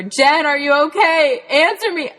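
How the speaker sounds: high, loud calling into the brush. Retold or imitated. Dad searching the woods for Jen, calling out in a high loud shout. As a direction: shout as if hunting for a lost child. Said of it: "he kept calling to me".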